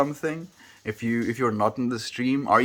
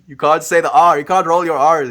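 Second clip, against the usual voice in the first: playful outrage; 6 semitones higher, 10 dB louder than usual. Real, not acted. Mock outrage about brei.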